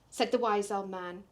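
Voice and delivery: usual speaking voice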